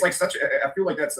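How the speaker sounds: ordinary delivery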